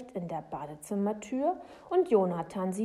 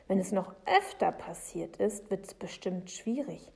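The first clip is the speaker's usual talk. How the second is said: plain reading voice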